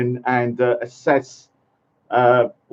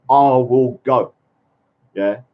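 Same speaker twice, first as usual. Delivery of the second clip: ordinary delivery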